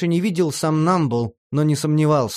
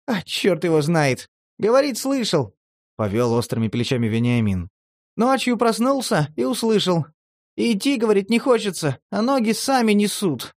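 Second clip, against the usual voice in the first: much higher, shrugging; Vena retelling; 6 semitones higher than usual. A narrator's reading. Vena shrugs off the coachman's story, much higher. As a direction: tell the creepy tale offhand.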